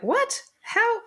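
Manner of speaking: high, short stunned questions; high-pitched mock bafflement; baffled. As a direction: sound utterly bewildered. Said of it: "and just like".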